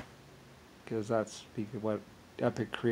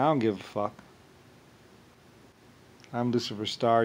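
ordinary talk, nothing marked

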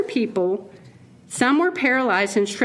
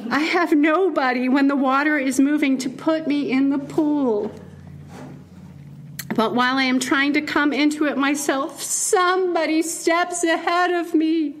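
badly faked gloomy donkey voice, pitched up; Eeyore; 5 semitones higher than usual. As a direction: drag out a self-pitying complaint, half-laughing attempt. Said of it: "I imagine an Eeyore voice. I can't do an Eeyore voice".

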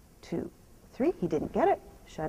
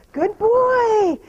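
ordinary talk, nothing marked

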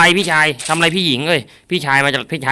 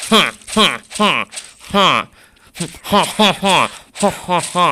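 higher ha ha battle grunts; mock kung fu kiai; 3 semitones higher than usual. Gamer doing kung fu cries while slashing, mock. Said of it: "ฟันทำไมต้องพูดเสียงอย่างนี้ด้วย".

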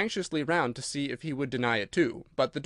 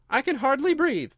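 high, louder squeezed complaint; complaining Dormouse; 11 semitones higher, 5 dB louder than usual. A narrator's reading. Dormouse squashed beside growing Alice, high-voiced complaining. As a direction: protest in a high little voice.

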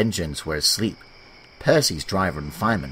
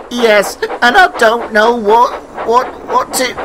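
panicked; 9 dB louder than usual. Acted. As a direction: stammer it out high and frantic. James still panicking about spots.

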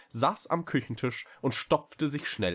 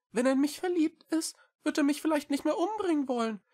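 very high, quick small-creature voice; little cat Echo, earnest; 3 dB louder, faster than usual. A narrator's reading. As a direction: plead eagerly in a high, fast voice. Cat justifies. (hohe, eilige Katerstimme)

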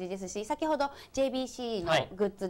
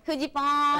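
loud stretched call of the name; 7 semitones higher, 5 dB louder than usual. Retold or imitated. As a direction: call the name across the stadium. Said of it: "って言ってくれるんです".

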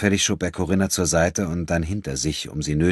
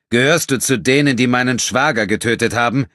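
accusing demand; 8 semitones higher, 8 dB louder than usual. Acted. Enrico accuses the Nubian.

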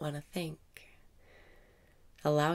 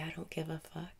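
soft whisper, softer than the cue; whispered affirmation; 3 semitones higher, 7 dB quieter than usual. Acted. Guide voicing a cheeky affirmation, soft whisper. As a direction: let the cheeky line slip out under the breath.

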